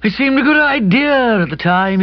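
put-on high-pitched, defensive young man; hapless young friend. A narrator's reading. Kipper excuses himself to the aunt, hapless and defensive.

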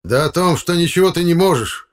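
high, loud taunting cry; shouted out. Acted. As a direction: yell the accusation in his face. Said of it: "выкрикнул дядя Лёня". (выкрик в лицо)

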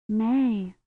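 soft, slightly dazed answer. A narrator's reading. Rosamond answers Kivrin after falling, softly dazed. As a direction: answer faintly, as if stunned. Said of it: "still sounding dazed".

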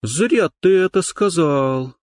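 slow, high, resolute; determined. Acted. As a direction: lay it down firmly and slowly. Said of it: "сказала Дана решительно".

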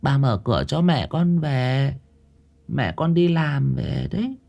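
a granddaughter urging grandma to open the door; insistent little girl. A narrator's reading. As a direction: plead like a child who hears her mother. Child insists mother knocks.

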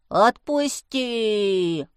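long drawn-out croaky plea; croaking kikimora. A narrator's reading. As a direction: croak it out, stretching the last vowel. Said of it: "проквакала кикимора". (квакающий голос кикиморы)

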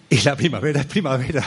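breaking into laughter at the end; laughing. Real, not acted. Speaker laughing at his own solemn teaching, laughing. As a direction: try to state it, then crack up. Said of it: "me río de mí mismo".

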